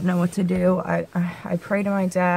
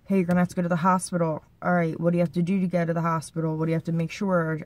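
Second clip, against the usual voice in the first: rapid, tumbling inner voice; fast, anxious self-talk; racing thoughts; faster than usual. Retold or imitated. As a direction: rattle it off like panicked thoughts. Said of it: "my thoughts race".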